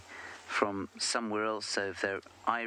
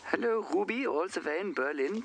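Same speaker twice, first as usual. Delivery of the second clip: hammed-up German accent, much higher; bad German accent; 10 semitones higher than usual. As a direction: greet her in a terrible German accent. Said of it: "my terrible German accent".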